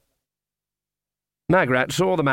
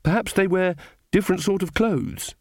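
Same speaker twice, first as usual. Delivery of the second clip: higher-pitched, mildly forlorn suggestion; hopeless; 7 semitones higher than usual. A narrator's reading. Magrat guessing about witches in the city, hopeless. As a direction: offer it with a touch of hopelessness. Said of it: "said Magrat hopelessly".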